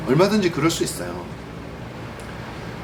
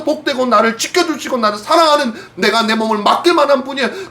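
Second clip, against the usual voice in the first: raised, pressing sermon voice; earnest preaching; 9 semitones higher, 4 dB louder than usual. Acted. Pastor urging his congregation, fervent preaching. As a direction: drive the point home to the pews.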